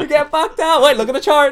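loud, high, hammed-up party yell; mock partygoer shout. Mocking partygoers bringing a chart to a party, hammed-up shout. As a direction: laugh while hollering like a drunk partygoer.

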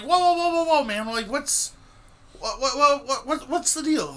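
high, stammering bewilderment; startled placating. Retold or imitated. Retelling his answer to the yelling friend, taken aback. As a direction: repeat yourself, trying to halt his tirade.

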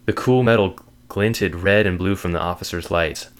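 plain reading voice

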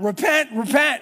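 high, loud cry of repent; loud preacher's cry, rising at the end; prophet shouting. Retold or imitated. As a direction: call it out like a prophet in the street. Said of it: "he continues to shout out".

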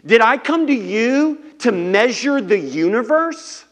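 God snapping at Job, loud and high. Acted. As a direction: snap back with sarcastic scorn. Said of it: "God snaps out for, like, three chapters".

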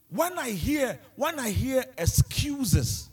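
full-force sermon climax; impassioned preaching. Acted. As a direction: preach it with rising fire.

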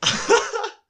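gargalhada. Real, not acted. Riso alto depois de pinchelar.

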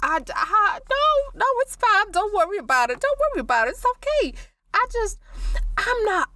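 high, fluttery nervous voice; nervous panic. Acted. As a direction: pitch up in rising panic, brushing it off.

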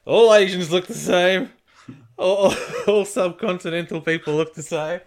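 teasing mock quote; mocking an ignorant person. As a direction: parody your friend as clueless. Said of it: "You're like".

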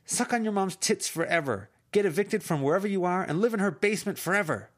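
high, loud venomous yelling; screaming insults. Acted. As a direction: spit the insults at him full force.

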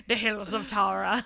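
put-on Irish accent. Friend riffing on a cliff show in Ireland, mock Irish accent. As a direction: put on a jokey Irish brogue. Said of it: "can you do an accent".

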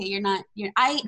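ordinary delivery

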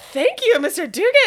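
playful happy imitation; mock grateful player. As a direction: gush it like a delighted player.

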